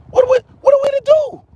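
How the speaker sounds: high, fast, squeaky mock plea; mock helpless plea. Ranting vlogger mocks being cornered, high squeaky plea. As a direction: throw the question up in a high, hurried squeak.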